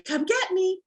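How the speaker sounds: high teasing call; inviting the dog into a chase game; playful invite. Shown as a demonstration. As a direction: lure the dog to chase you. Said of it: "roll away from them and say".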